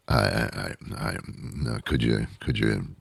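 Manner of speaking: low, hushed fumbling; quiet, low pretend bewilderment; mock flustered stammer. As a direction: stumble softly over the words. Acts a flustered answer to silly question.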